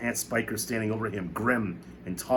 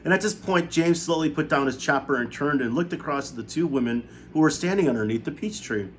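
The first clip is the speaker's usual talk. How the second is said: usual speaking voice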